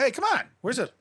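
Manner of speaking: high-pitched plea for applause; mock protest. Eye-eater to an unimpressed crowd, mock protest. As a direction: protest as if cheated of a reaction.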